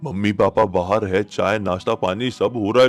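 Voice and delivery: usual speaking voice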